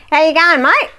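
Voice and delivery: put-on high, loud outback Aussie accent; broad Australian accent. Outback petrol station man serving a traveller, broad Australian accent. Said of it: "he spoke to me like this".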